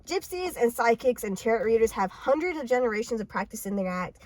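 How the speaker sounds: usual speaking voice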